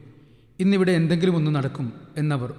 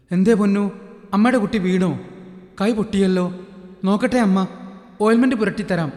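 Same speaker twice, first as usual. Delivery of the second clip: higher, louder fussing mother voice; comforting mother; 4 semitones higher, 3 dB louder than usual. Acted. Shantha fusses over hurt Ponnu, comforting. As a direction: soothe the crying little one.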